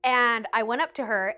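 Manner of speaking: normal talking voice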